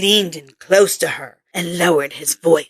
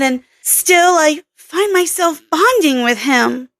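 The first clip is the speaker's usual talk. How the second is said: lightly raised voice for Kelly, a bit curt; a hint of a brusque young scientist; Kelly's voice; 7 semitones higher than usual. Kelly's voice held.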